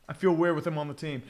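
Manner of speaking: ordinary delivery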